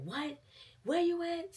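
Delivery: a mother jolted by news, shrieking; shocked mom. Retold or imitated. Mama on the phone hearing the news, shrill shocked cry. Mama gets the police call.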